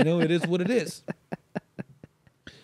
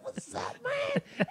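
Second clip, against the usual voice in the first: very high, breathy squeal through a laugh; excited greeting; 7 dB quieter, much slower than usual. Retold or imitated. Other guy greeting the teller, giddy squealing hello. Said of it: "He got it all excited".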